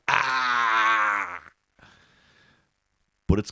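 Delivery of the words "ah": mock shout. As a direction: yelp as if dodging a car. End of Frogger rant.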